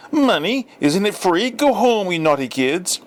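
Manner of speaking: high, mock-scandalised scolding; scolding housewife. A narrator's reading. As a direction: shoo the kids away, feigning innocence. Housewife scolding through door.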